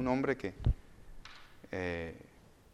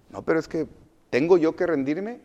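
reluctant objector; 4 semitones higher, 7 dB louder than usual. Acted. Voices a reluctant believer objecting.

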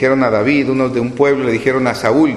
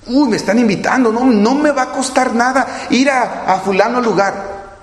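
much higher, gleeful and coaxing; mock delight; 11 semitones higher than usual. Imitating someone tempted by a free trip, hammed-up delight. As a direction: gush about the free invitation. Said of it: "A veces uno dice".

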